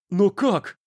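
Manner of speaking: high, startled exclamation; surprised exclamation. A narrator's reading. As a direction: cry out in disbelief. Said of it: "воскликнул Андус Фейн".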